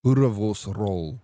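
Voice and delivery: Boerewors roll said with a trilled Afrikaans R. Shown as a demonstration. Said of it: "I like your R's at the end of lekker".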